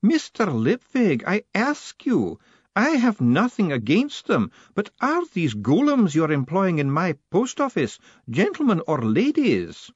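a formidable lady laying down the law; imperious high-pitched battleaxe; demanding old woman. Acted. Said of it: "the terrible woman demanded".

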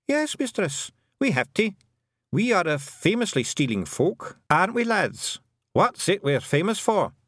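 high, lively little clansman's voice; helmet twiddler, proudly rallying. A narrator's reading. Tiny man calling on his lads, proud.

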